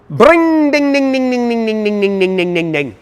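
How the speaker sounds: high ringing ding-ding engine imitation winding down; two-stroke engine noise. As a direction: rev it up with your voice then let it fade. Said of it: "That's the noise that this would make".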